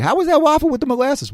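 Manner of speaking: high-pitched, eager hostess question; imitated Southern host. Host to guest eating the waffle, imitated high-pitched eagerness. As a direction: ask it high, like a doting host. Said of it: "It's like".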